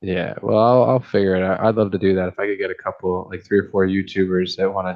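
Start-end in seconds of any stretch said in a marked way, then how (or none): none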